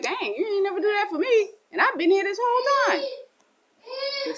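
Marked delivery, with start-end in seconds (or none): 0.0-3.0 much higher, whiny grievance; jealous complaint. Acted. The faithful son complaining to father, much higher and whiny. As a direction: sulk that you were never rewarded.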